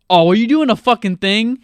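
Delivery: high, loud mocking question; incredulous, jeering high voice; mocking Dreyfuss quote. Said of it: "And Richard Dreyfuss is like".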